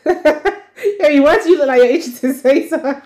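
teasing through laughter; high, giggly teasing; laughing. Real, not acted.

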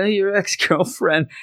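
high, imitated frightened voice; mock scared. Randy telling Pete who is inside, mock fear. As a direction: keep the put-on panic high in pitch. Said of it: "He is so scared".

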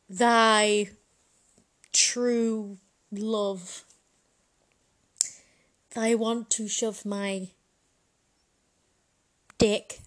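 loud, slow mock-pompous lord; hammed-up lord. Victorian lord writing a dirty note, loud mock-pompous. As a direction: declaim it like a randy old lord. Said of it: "and he's like".

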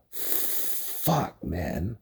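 breathy stunned whisper of disbelief; whispered curse. Real, not acted. Viewer reacting to a twist, whispering a curse. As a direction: let it slip out under your breath.

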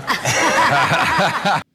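awkward uneasy laugh; nervous laugh. Real, not acted. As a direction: laugh it off uncomfortably. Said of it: "that's an uncomfortable laugh".

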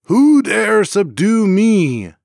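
booming slow challenge to the sky; crying out. A narrator's reading. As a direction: roar it slowly, daring anyone. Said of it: "he looked out to the sky and cried".

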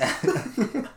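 high laugh. Real, not acted. Laughs after the imitation.

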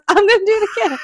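high-pitched imitation of the friend; squeaky mock quote; quoting Bree, high. Mocking Bree's cocky last roll, high voice. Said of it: "And she goes".

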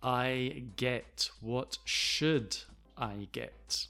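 every word apart, slow; slow model question with pauses; word-by-word textbook reading; slow and careful. Shown as a demonstration. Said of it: "look how we do not say".

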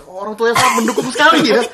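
high laughing tease. Real, not acted. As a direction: laugh through the words. Teasing about hentai comics. (tertawa sambil menggoda)

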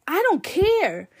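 high, drawn-out "I don't care"; mock outburst. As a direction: fling it back at the aunt. Angry retort to aunt.

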